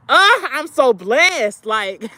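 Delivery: high, drawn-out burst of gratitude; overwhelmed gratitude. Real, not acted. Overwhelmed with gratitude, near emotional.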